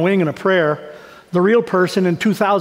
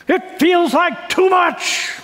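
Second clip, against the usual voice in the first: slow, higher, near a cry; overwhelmed; 10 semitones higher, much slower than usual. Retold or imitated. Pastor reading her note, slow overwhelmed cry. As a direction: let it break out, overwhelmed. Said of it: "She wrote this".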